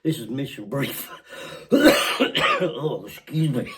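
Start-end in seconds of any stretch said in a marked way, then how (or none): none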